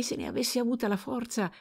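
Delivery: plain reading voice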